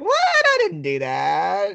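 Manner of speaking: high, drawn-out feigned disbelief; mock-innocent denial. Voices Buck's mock-innocent denial.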